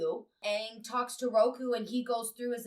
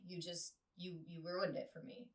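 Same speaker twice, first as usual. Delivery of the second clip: inner thought; 4 semitones lower, 11 dB quieter than usual. Retold or imitated. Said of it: "she's thinking in the back of her head".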